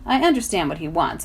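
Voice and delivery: ordinary delivery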